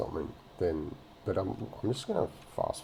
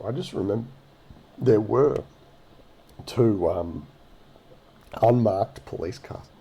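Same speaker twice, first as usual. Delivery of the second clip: hiccuping mid-sentence; words jolted by hiccups; 3 semitones higher, 6 dB louder than usual. Real, not acted. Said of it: "Oh, sorry, I got the hiccups".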